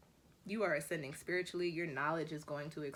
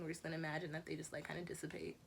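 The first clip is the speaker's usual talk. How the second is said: normal talking voice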